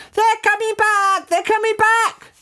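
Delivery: very high, urgent cry; high alarm cry. A narrator's reading. Alarm cried in the story.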